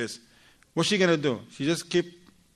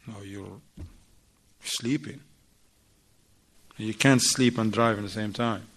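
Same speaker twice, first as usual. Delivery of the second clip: firm warning; 5 semitones lower than usual. Retold or imitated. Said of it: "now she's gonna change her tone".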